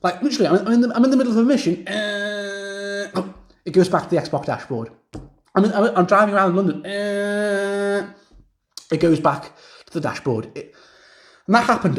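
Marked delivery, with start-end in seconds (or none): 1.9-3.1 long monotone "uh" like a frozen game; imitated crash drone. Gamer mimicking a mid-mission crash, droning noise. 6.8-8.1 sustained monotone freeze noise; imitated crash drone. Gamer mimicking a crash while driving, droning noise.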